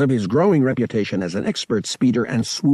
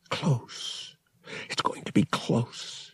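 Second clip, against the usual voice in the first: soft, near-whispered worry; hushed inner thought; 3 semitones higher, 7 dB quieter, slower than usual. Acted. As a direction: think aloud under your breath. Han's tense private thought.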